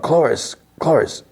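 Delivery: high-pitched hushed-volume yell of a name; mock calling out. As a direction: imitate hailing someone far away, without real volume. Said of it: "Andor yells out".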